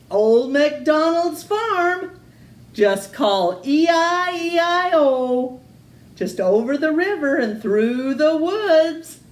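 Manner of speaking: high, loud announcer read. Acted. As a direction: sell it like a cheesy ad. Reading the farm ad.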